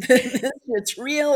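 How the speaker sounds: loud, slow, laughing through the words; loud amazed laugh-talk. Real, not acted. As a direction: burst out laughing as it sinks in. Laughing repeat of realization.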